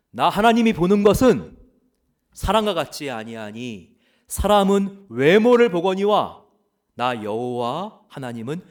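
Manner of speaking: booming, loud proclamation of God's word. Acted. Preacher voicing God rebuking Samuel, loud and commanding. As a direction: thunder it out as God rebuking the prophet. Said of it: "이렇게 말씀하셨습니다".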